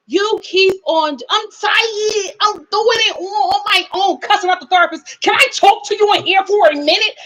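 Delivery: high, whiny fake crying voice; mock crying complaint. Mocking Sarah crying at therapist.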